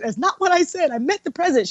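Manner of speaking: high-pitched, softened exasperation; exasperated correction. Retold or imitated. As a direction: insist to mom, high and held back. Correcting mom who misunderstands again.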